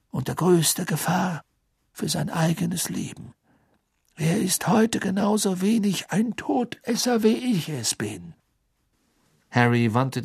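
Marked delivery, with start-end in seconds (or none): 0.1-8.3 put-on calm, higher elderly voice; firm old wizard. Dumbledore vouches firmly. (ruhige feste Stimme eines alten Zauberers)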